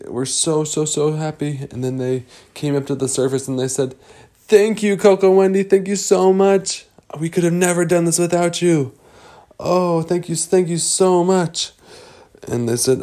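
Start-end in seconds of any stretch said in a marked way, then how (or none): 4.3-11.7 high-pitched effusive thanks; grateful gushing. Acted. Fish thanking their rescuers, high grateful gushing. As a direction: gush with gratitude, voice lifted high.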